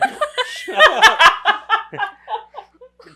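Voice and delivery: high burst of laughter; loud laugh. Real, not acted. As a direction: crack up loudly.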